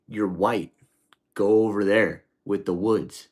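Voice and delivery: loud, firm order from a cellmate; cellmate's order. Retold or imitated. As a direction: bark the order across the cell. Cellmate ordering him across.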